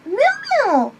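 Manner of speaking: high pet call. Real, not acted. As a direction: sing out the name to the cat. Calling the pet across room.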